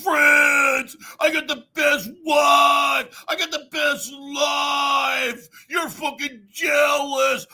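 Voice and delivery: high, slow, loud boastful shouting; mock bragging Mark Thornton. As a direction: boast like a puffed-up show-off.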